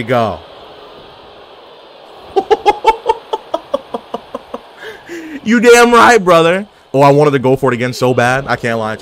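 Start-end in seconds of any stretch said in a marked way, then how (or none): 2.3-4.6 long high-pitched staccato laugh; rapid squeaky laughter trailing away; high, pulsing cackle; high giggle. Real, not acted. Reaction to game moment. 5.4-6.7 high, loud triumphant holler; exultant gamer yell; hyped shout. Real, not acted. Celebrating a move in game.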